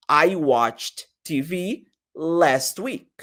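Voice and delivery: I watched T V last week said slowly; slow model sentence. Shown as a demonstration. Teacher modeling the full past tense sentence. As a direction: read it slowly for the class. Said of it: "ao invés de falar watch".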